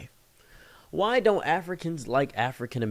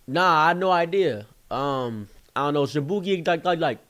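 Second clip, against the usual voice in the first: higher mock slang voice; 5 semitones higher, 3 dB louder than usual. Man from the group guessing the president, mock street voice. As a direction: mimic a clueless reply from the group. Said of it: "And he says".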